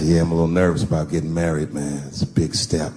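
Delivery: low, easy friend voice; deep friend's voice. Retold or imitated. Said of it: "he said".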